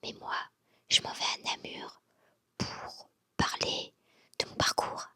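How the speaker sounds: early morning whisper, everyone asleep; library whisper. Real, not acted. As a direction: whisper so nobody wakes up. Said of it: "Je parle tout bas parce que tout le monde dort encore".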